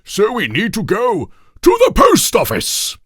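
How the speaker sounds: king to his friends, dramatic and high. A narrator's reading. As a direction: announce it like a pompous king. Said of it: "said King Toothbrush Weasel dramatically".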